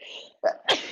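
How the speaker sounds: a real sudden sneeze; one sharp sneeze into the mic; sneezing. Real, not acted. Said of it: "I just sneezed in all of your ears".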